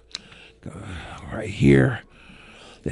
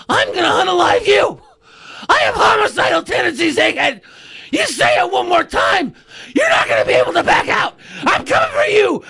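shouted wrestling promo; 10 semitones higher than usual. Pretend. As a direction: bellow the threat at him. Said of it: "gonna give you a good promo".

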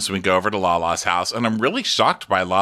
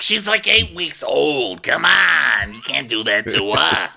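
higher, slow whiny put-on voice; mock pleading dad; 5 semitones higher, slower than usual. Mocking Randall objecting to baby crop tops, whiny high voice. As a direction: plead like a fussy new father.

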